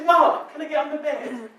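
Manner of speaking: the labouring mother's plea, imitated; mimicking the woman in labour. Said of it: "I'm doing that voice".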